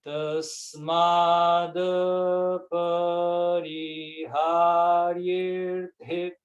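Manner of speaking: Tasmada pariharyerthe joined, very slow; slow joined mantra phrase; linked slowly. Shown as a demonstration. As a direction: chant both words linked, drawn out. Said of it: "a gente tem que unir".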